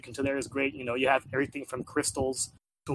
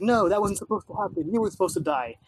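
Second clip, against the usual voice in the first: put-on higher, louder spoiled kid; lightly voiced angry kid; bratty kid voice; 7 semitones higher, 4 dB louder than usual. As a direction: whine it like a sore-loser brat. Kid angry wolf survived.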